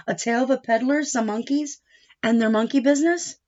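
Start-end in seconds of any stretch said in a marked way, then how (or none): none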